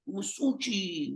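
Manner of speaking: slow, stretched New York Masucci; a lightly hammed New York Italian twang; New York accent. Shown as a demonstration. Host names the guest in a New York accent. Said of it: "with a New York accent".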